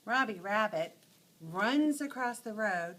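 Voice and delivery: ordinary delivery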